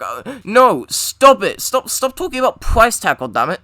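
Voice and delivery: loud, high frustrated yelling; exasperated outburst. Acted. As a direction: yell at them to stop.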